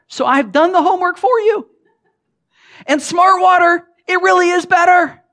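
big high excited reveal; excited build-up. Acted. Preacher to congregation, mock-excited pitch.

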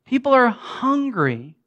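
slow, deliberate "People are hungry"; slow preaching emphasis. Acted. Preacher to congregation, slow and weighty. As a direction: let it land slowly.

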